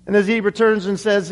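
usual speaking voice

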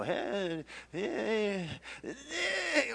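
rising effortful heave. Acted. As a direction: act out swinging someone overboard. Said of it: "did the old heave-ho".

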